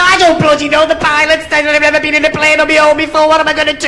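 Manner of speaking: high, frantic comic voice; mock panic. Novice flyer begging the controller for help, panicked. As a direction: gabble helplessly up high. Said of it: "Calm down, calm down".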